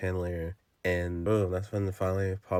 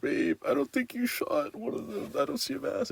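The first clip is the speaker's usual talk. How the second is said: put-on much higher falsetto girlfriend voice; mock girlfriend voice. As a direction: squeak it like a worried girlfriend. Maya voiced in retelling.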